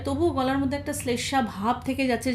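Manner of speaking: normal talking voice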